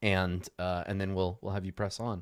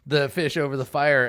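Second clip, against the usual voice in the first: ordinary talk, nothing marked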